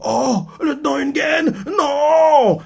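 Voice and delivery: cri aigu et désespéré; cri inquiet. Retold or imitated. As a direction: des soldats voient tomber leur lieutenant. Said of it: "Inquiet, quoi". (cri d'angoisse imité, plus aigu)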